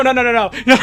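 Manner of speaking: ordinary talk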